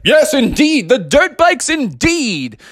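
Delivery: high, booming, grandly over-formal dad; pompous Father Bear. Acted. Father Bear ordering dirt bikes for the family, pompous proclamation. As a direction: declare it with hammed-up pomp. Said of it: "And Father Bear was like".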